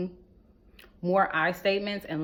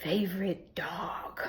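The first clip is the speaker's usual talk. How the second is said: soft unvoiced whisper on favorite dog; whispered aside; 7 dB quieter, slower than usual. Real, not acted. Telling wives to treat husbands like pets, whispered aside. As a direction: drop to a whisper for the joke. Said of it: "whispered punchline".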